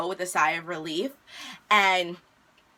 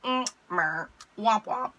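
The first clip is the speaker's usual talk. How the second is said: unimpressed, letdown meh and womp womp; a bored sad-trombone womp womp; deflated meh. Retold or imitated. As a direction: sound thoroughly underwhelmed. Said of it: "Like that".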